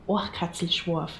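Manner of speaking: Oachkatzlschworf as a Bavarian says it, OAKH-katsl-shvorf; Bavarian test word. Shown as a demonstration. Bavarian testing foreigners on Oachkatzlschworf, native OAKH-katsl-shvorf pronunciation. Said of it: "how can you speak".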